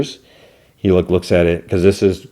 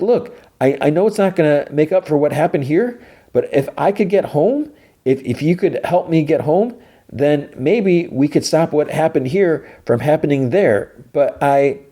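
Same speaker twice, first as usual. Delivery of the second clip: high, halting appeal; imitated earnest plea; pleading; 8 semitones higher than usual. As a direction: reason urgently, voice pitched up. Begging Solo to help.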